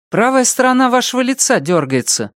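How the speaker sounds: brisk questioning doctor; female doctor voice. Acted.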